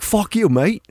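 high-pitched, badly faked Antipodean accent; attempted Antipodean accent. One mate cussing another, in a shaky attempt at Antipodean accent. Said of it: "See the difference there?".

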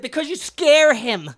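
slow, high, very loud comeback; shouted retort. Retold or imitated. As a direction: yell the reason to him in mock exasperation. Retort in retold argument.

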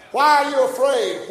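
high, loud preacher's challenge; shouted rebuke. Acted. Preacher voicing the Lord's challenge to him, shouted rebuke. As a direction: shout it as a forceful demand.

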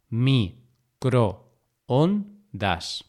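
microondas pulled apart very slowly, mi, cro, on, das; long pauses between all syllables of "microondas". Shown as a demonstration. Teacher repeating microondas to show the hiato, syllable by syllable.

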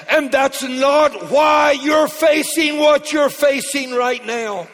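high, loud and slow, building to a shout; full-force preaching. Real, not acted. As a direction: drive it home to the congregation.

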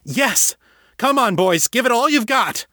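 eager, high-pitched rallying cry; brightened urging. A narrator's reading. Harold rallying his boys, excited and high. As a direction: cheer the crew on with sudden hope. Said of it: "Harold's voice brightened in realization".

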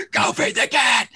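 retold stern yell of a mom; mock yell at son. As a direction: bark the order, hammed up. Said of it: "totally yelled the junk out of him".

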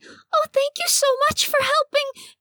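very high, squeaky creature voice; duck lady voice. Acted. GM voicing Cecily thanking the heroes, high and squeaky. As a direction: pitch it way up like a fussy little duck.

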